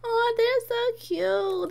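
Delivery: high, slow, stretched coo; high drawn-out aww. Real, not acted. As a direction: gush over them. Cooing at cute Sims couple.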